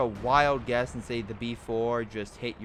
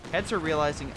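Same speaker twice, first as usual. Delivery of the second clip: ordinary delivery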